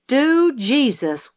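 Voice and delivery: high, slow, drawn-out exclamation; exclamation going off. A narrator's reading. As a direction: let it go off down the hall. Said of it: "went off in the hall".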